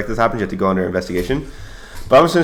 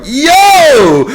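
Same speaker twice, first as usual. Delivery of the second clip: long, high, loud shocked shout; startled yell; 9 dB louder than usual. Retold or imitated. Troops reacting to the radio call, startled yelling. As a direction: holler it out in alarm.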